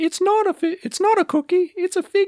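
put-on very high, squeaky kid voice; commercial kid voice. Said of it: "And they'd be like".

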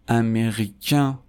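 slow امریکن ending in a nasal vowel; French masculine امریکن with nasalized last vowel. Shown as a demonstration. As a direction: say امریکن slowly, final n silent, vowel through the nose. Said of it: "به خاطر اینکه توی بینیه".

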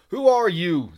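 loud pirate roar. Acted. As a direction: roar the question at him. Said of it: "they roared".

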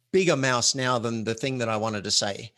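normal talking voice

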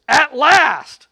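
loud, high cry of relief; exclaimed. Acted. Preacher playing Adam, loud joyful exclaim. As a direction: burst out with it, overjoyed. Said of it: "the man exclaimed".

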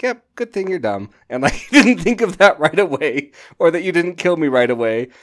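loud, high, laughing gloat; hammed-up triumphant laugh-talk; gleeful gloating. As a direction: crow it out between laughs at her mistake. [name] gloating after spitting artifact.

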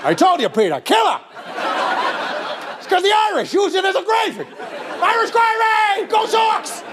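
high, crowing dad voice; delighted dad. Acted. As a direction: build to a gleeful high holler. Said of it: "He's gonna be so happy you laughed".

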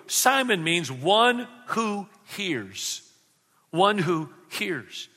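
slow, lingering on each word; slow and deliberate. Real, not acted. Preacher explaining the name to the congregation, slow and deliberate. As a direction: let the meaning sink in slowly.

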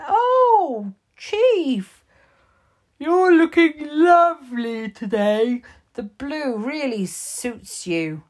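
high, loud sweet-talk; butter up the chief, all innocence; flattering Shouty Pants. Acted. Flattering the chief to escape trouble.

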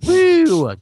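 loud, high mock whoop; sarcastic cheer. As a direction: whoop it up in fake celebration. Sarcastic cheer over reboot plans.

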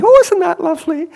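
high, half-hearted Glaswegian lady's voice; a self-confessed poor Glaswegian accent, pitched high; attempted Glaswegian lady. Glaswegian lady touched by the offered prayer, in a high, mock Glaswegian accent. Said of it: "can't do my Glaswegian accent".

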